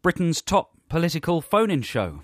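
high, punchy talk-radio intro; mock phone-in host. Host opening a spoof political phone-in, mock presenter voice. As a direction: sell it like a hard-hitting political phone-in presenter.

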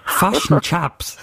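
slow, exaggerated Kiwi "fush and chups"; slow mock New Zealand accent; copied New Zealand accent. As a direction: badly copy a New Zealand accent, drawn out. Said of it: "I'm doing what he did".

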